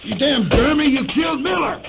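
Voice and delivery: high, shouted rage; furious shout. Acted. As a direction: scream your fury at her. Man yells after his friend dies.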